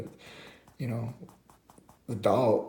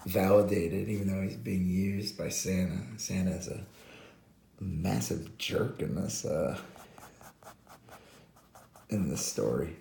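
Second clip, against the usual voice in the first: normal talking voice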